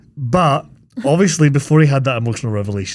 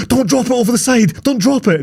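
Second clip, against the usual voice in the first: pleading crew; 11 semitones higher, 3 dB louder than usual. Retold or imitated. Crew begging her in retelling.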